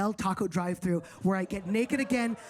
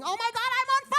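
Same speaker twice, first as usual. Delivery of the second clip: high panicked scream, much higher; 13 semitones higher, faster than usual. Retold or imitated. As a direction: scream in panic that you're on fire.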